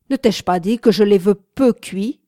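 loud, angry scolding in character; violent outburst. A narrator's reading. Man berating the young woman over his eggs, violent anger. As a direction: snap a furious, loud rebuke. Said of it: "avec une violence qui fit trembler".

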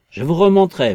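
loud, high burst of threat; menacing. A narrator's reading. Invisible man threatening to buck Marvel up, menacing. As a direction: come down hard on him.

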